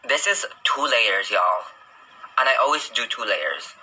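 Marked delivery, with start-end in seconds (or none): none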